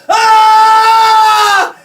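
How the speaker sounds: long, high, very loud scream. Retold or imitated. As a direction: scream it out at full volume. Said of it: "I scream".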